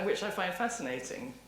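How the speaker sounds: ordinary talk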